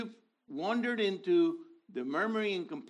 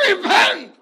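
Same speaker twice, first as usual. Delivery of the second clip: booming prophet cry, much higher and much louder; Charlton Heston "Repent!"; 18 dB louder than usual. Pretend. Preacher imitating Charlton Heston's bellowed repent. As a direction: thunder it out like Moses in the movie. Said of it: "If I could have a Charlton Heston costume".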